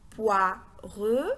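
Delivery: "poireux" said as pwa, pause, reu; two separate held beats on "poireux"; split into syllables. Shown as a demonstration. Models poire split for spelling.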